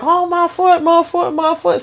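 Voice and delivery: high, pained wailing over a broken foot; acted pain moan. Man beaten with a pipe, moaning on the floor in high pain. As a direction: wail it like the foot is shattered.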